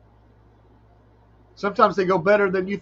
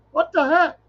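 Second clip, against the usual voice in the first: baffled; 11 semitones higher than usual. Real, not acted. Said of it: "bewildered aside".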